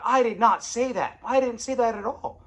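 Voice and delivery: loud, high protest; heated denial. Retold or imitated. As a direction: insist you never said it.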